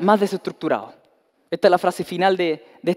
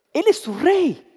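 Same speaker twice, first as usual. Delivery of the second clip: higher, emphatic declaration; Pilate declaring; 10 semitones higher, slower than usual. Acted. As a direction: insist on it.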